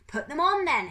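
high, loud yell back; screamed retort. Acted. Pickering to the helmetless men, screaming. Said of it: "Pickering screamed".

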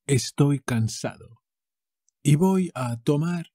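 ordinary talk, nothing marked

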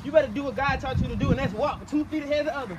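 lightly hammed-up sermon voice; mock preaching. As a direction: preach it like a pastor, half joking. Preaches to self while walking.

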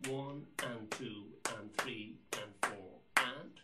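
long-short swung and; swung count. Shown as a demonstration. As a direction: lean the count into a swing feel. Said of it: "We can still say and".

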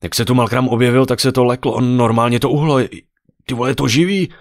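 loud, high, agitated report; astonished yelling. A narrator's reading. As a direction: blurt it out in amazed alarm. Adrian keeps yelling in astonishment.

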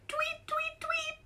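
whispered high tweeting; bird tweets. A narrator's reading. Arnold hearing birds up the tree, whispered high tweets. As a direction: chirp it in a tiny whisper.

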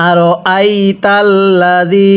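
drawn-out Arabic recitation, slow; slow Quran recitation. Shown as a demonstration. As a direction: recite slowly for students to repeat. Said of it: "হ্যাঁ, পড়ুন।". (ধীরে কুরআন তিলাওয়াত)

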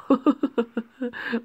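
high, loud laughter; cracking up. Real, not acted. Reader amused by the character introduction, cracking up. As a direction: burst out laughing mid-read.

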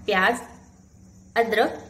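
ordinary talk, nothing marked

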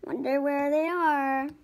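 usual speaking voice

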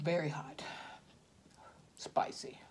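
hushed whisper, mouth burning. Real, not acted. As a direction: whisper it through the heat. Eating spicy noodles.

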